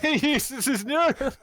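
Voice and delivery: high, loud laugh of disbelief. Real, not acted. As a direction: laugh it out, incredulous. Laughing disbelief at absurd claim.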